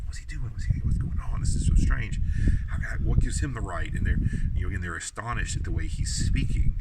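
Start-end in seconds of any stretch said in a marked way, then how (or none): 0.1-3.9 high, loud, incredulous murmuring; baffled crowd. Retold or imitated. Congregation astonished by the teacher, loud baffled questions. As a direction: voice the stunned crowd questioning his right.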